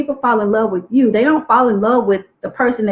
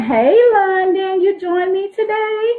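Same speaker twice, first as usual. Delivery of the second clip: bright greeting; 10 semitones higher than usual. Real, not acted. Greeting a viewer who joined.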